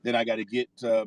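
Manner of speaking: ordinary talk, nothing marked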